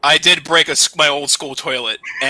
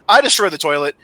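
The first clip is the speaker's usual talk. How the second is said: ordinary talk, nothing marked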